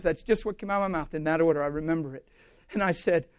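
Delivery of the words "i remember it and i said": ordinary delivery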